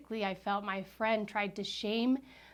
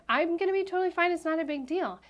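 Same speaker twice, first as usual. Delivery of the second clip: higher, hurried brush-off; retold insistent reassurance; 8 semitones higher, 4 dB louder than usual. Guest shamed for her diet, retold hurried reassurance. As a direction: quickly play down the fuss.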